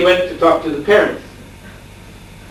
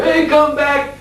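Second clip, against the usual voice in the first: high-pitched call after a runaway; calling after someone; 11 semitones higher, slower than usual. Retold or imitated. Father chasing his fleeing son, calling out high. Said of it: "trying to run after him".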